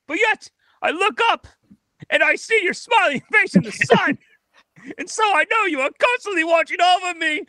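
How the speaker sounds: high, grand melodramatic lament; tragic heroine sorrow. Acted. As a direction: wail it like a tragic hero. Actor performs Hamlet monologue.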